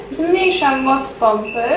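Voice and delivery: normal talking voice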